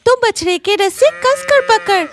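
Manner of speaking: put-on a brisk aunt telling the boy what to do. Narrator voices aunt Madhavi. (गांव की काकी की ऊँची आवाज़)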